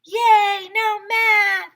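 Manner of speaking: high, loud cheer; yelled. Acted. Kid yelling happily about skipping math, high and loud. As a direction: yell with glee. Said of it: "I yelled".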